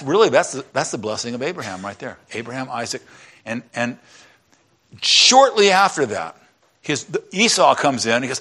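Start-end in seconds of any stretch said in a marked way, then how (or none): none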